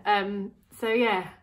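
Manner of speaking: ordinary talk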